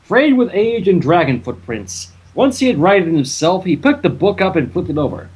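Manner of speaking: normal talking voice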